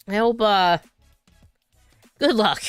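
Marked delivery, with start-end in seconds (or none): none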